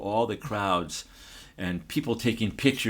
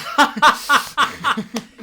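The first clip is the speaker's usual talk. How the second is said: loud, high-pitched burst of laughter; hearty laugh; 13 dB louder than usual. Real, not acted. Storyteller tickled by the host's egg salad joke, real hearty laugh. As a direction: crack up loudly at a joke.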